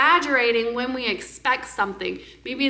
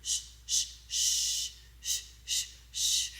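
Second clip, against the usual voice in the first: hushed, soft shh sounds; soft shushing; 12 dB quieter, slower than usual. Retold or imitated. Mama soothing a baby, whispered shushing. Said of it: "when the mama goes".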